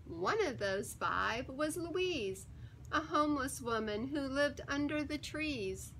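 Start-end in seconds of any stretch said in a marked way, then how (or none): none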